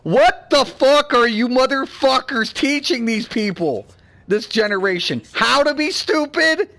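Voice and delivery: retold outburst. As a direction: replay the moment you went off on them. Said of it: "I'm like".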